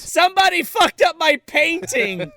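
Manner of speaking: high, loud cartoon outburst; mock outrage. Dorian Gray finds his painting ruined, high mock-outraged yell. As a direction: holler it in hammed-up dismay.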